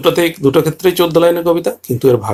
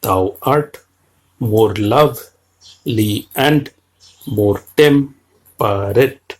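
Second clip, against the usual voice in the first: very slow, unstressed then stressed syllables drawn out; iambic reading; 3 semitones lower, much slower than usual. Shown as a demonstration. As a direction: scan the line beat by beat. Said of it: "পরের লাইনটাও একইভাবে রয়েছে".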